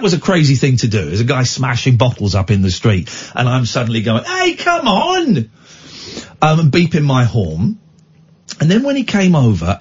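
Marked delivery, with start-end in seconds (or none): 4.2-5.5 high, drawn-out holler; shouting out the window. Retold or imitated. Driver suddenly calling to a man smashing bottles, shouting. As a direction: yell it to someone down the street. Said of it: "I started shouting out of the window".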